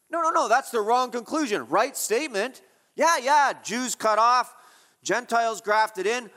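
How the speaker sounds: preaching at full force; forceful correction. Real, not acted. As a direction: correct them with rising force. Preacher rejects the wrong conclusion.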